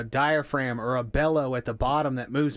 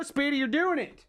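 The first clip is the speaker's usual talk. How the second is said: excited cheer. Real, not acted. Cheering a friend on.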